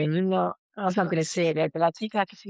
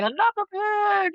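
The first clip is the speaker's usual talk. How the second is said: much higher, louder mock panic; mock inner voice; 4 dB louder, slower than usual. Grieving daughter voicing her own panicked thoughts, high mock shout. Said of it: "even though I'm thinking".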